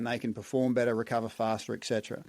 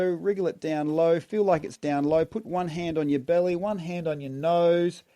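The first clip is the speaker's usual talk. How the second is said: urgent, louder breath coaching cues; energized breathwork coaching; 4 semitones higher, 3 dB louder than usual. Shown as a demonstration. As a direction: drive the exhale with rhythmic calls. Second breathwork round cues.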